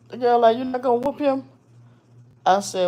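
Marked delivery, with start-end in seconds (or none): 0.2-1.5 high, put-on indignant woman; mock girlfriend's voice. Scene: girlfriend demanding the child be punished, high mock outrage. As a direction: squeal it like the offended girlfriend.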